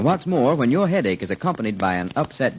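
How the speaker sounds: ordinary delivery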